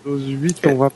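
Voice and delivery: distant, off-mic voice; far from mic. Real, not acted. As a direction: speak from across the room. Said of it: "Comme si tu parlais trop loin du micro".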